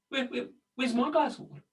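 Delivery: a put-out bear whining for his water; mock bear asking. Playful bear whine while he drinks from a creek. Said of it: "one of the bears goes".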